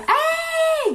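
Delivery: very high, loud call; shouted greeting. Acted. Indonesian spotting a friend on the street, shouted greeting. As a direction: holler to catch a friend's attention. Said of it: "kalau kita tuh teriak".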